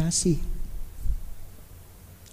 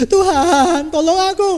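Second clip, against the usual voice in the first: high, loud cry for help to God; hammed-up yelling prayer; shouted plea; 9 semitones higher, 5 dB louder than usual. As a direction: call out desperately, pitched right up. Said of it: "Teriak-teriak sama Tuhan Yesus".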